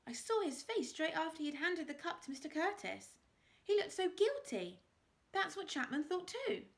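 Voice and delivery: put-on high, earnest girl; girl insisting on what she saw; young girl voice. A narrator's reading. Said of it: "said Inspector Priestley".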